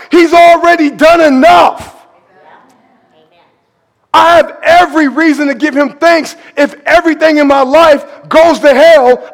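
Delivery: loud, high, shouting sermon; high and loud, driving the point home; full-force preaching. Acted. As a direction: preach to the rafters.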